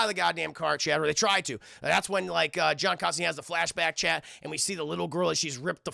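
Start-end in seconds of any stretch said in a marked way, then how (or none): none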